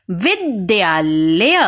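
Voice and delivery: विद्यालय said slowly syllable by syllable; teacher models विद्यालय slowly to the class; slow correct word. Shown as a demonstration. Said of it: "एक बार फिर से बोलते हैं".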